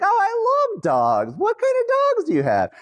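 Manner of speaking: mock the overeager call center agent gushing; gushing phone rep. Phone rep hears a dog barking, gushing high. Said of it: "they're like".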